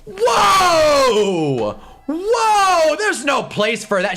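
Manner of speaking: high, drawn-out mock shout of outrage; imitating livid outburst. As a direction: mimic an opponent losing it, voice shooting up. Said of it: "this woman on the other team loses it".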